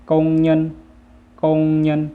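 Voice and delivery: กงเงิน as Vietnamese, high, level and loud; Vietnamese pronunciation. Shown as a demonstration. Teacher models the word for worker, Vietnamese pronunciation of กงเงิน. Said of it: "ภาษาเวียดจะว่าว่า".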